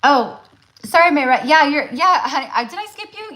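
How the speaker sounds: startled oops to the skipped sitter; flustered apology. Real, not acted. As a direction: catch your own slip and apologise. Realises she skipped a sitter.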